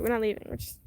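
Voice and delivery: usual speaking voice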